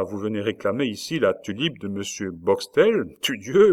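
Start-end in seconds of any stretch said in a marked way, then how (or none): 3.2-3.7 sudden louder, higher exclamation; indignant oath. A narrator's reading. President outraged at Rosa's claim, loud indignant oath. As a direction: spit out the old oath in outrage.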